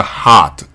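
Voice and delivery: ハート as hot, 'ah' tinged with 'o'; American hot, light final t; American hot vowel. Shown as a demonstration. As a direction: say ハート as an open 'haaht'. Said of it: "おがちょっと入った".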